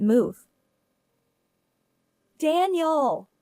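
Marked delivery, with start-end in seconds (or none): 2.4-3.3 calling out. A narrator's reading. Sister to her injured brother on the road, calling out. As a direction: call his name in alarm. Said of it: "as I cried out".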